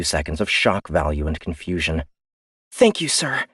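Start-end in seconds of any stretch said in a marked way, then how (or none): 2.7-3.4 higher, crisp officer's reply; young woman officer. Acted. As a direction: answer a superior, eager and polite. Ian answers Gant.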